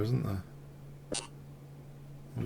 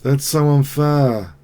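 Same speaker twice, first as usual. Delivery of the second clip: slow, louder drawn-out whine; 3 semitones higher, 8 dB louder, slower than usual. Real, not acted. Whining after losing.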